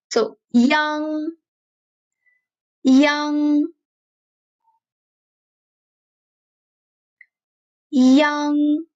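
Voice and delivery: put-on young with a back nasal ng. Shown as a demonstration. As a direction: end young in the back of the throat, ng. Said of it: "back nasal sound".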